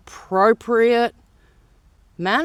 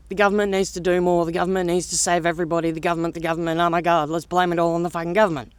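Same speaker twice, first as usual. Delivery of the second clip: mocking rant; 8 semitones lower, 3 dB quieter, much faster than usual. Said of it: "the story they wanted to write".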